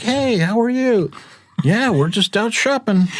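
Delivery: high, friendly replies; imitating cheerful twins. The Van Arsdale twins greet the driver, high and cheerful. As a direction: chirp back a friendly hello.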